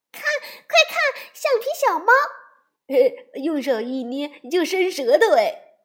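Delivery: high, loud cub voices, laughing; excited shout. Acted. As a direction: cry out at the squeaky toy. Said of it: "孩子们又喊了起来". (孩子们看到橡皮小猫，又笑又大声喊)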